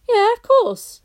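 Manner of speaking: put-on a proud little boy; Barney voice. A narrator's reading. Barney brushing off Lou, high proud boy voice.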